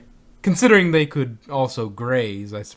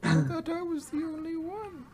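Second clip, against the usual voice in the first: very high, soft falsetto; mock hurt; 7 dB quieter than usual. Replying to friend's tease, mock-hurt falsetto. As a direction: play wounded in a tiny high voice.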